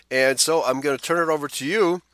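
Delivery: ordinary talk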